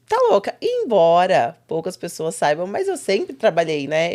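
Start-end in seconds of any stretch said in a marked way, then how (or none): 0.1-0.5 incredulous. Retold or imitated. Retold reaction to job offer.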